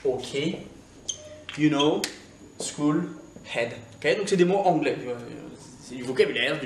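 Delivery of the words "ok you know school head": standard English word list; traditional English accent. Shown as a demonstration. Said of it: "je l'ai dit en anglais traditionnel".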